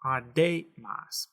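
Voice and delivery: "A de mas" drawn out, weight on DE; misplaced middle stress. Shown as a demonstration. Teacher repeating what not to say, A de mas stressed on DE. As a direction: stretch A de mas and lean on DE. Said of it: "that's not what we want to say".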